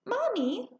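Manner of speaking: put-on high rising call of a little girl; child's call. A narrator's reading. Girl calling her mother, high and questioning. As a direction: call up to mother, young and curious. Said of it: "I asked".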